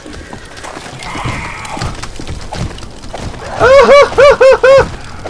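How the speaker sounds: very high-pitched repeated whoops; a string of high, frantic hoots; panicked whooping. Real, not acted. Injured, cornered in the game.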